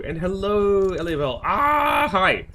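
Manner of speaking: high, loud Ah; bright greeting. Real, not acted. Streamer greets chatter L A L, high and loud.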